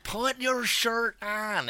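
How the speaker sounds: high, slow exasperated plea; mock exasperated high voice; high slow mock order. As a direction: drag it out high and pleading. Telling drunk woman.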